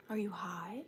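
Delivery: breathy teasing whisper; hushed playful whisper. Real, not acted. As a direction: lean in and whisper the question.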